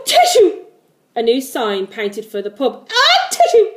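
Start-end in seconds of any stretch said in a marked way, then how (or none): 0.0-0.6 loud, high atishoo with a gasp before it; big acted sneeze mid-sentence; mock sneeze. Sneeze interrupting the character's sentence. 2.9-3.7 explosive high-pitched atishoo; big hammed-up sneeze; mock sneeze. Second sneeze interrupting the sentence.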